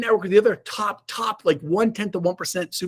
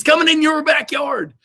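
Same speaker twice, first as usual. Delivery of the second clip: higher, louder rallying call; hype shout; 8 semitones higher, 4 dB louder than usual. Real, not acted. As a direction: pump up the crowd. Hyping the event to the audience.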